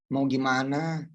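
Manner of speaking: usual speaking voice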